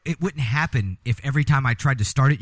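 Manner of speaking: ordinary talk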